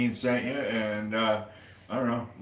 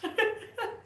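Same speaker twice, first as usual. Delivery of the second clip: high laugh. Real, not acted. Laughs at own joke.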